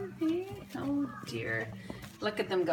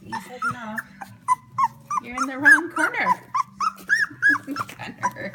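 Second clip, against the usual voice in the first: laughing; 8 dB louder than usual. Real, not acted. Laughing at lost puppy.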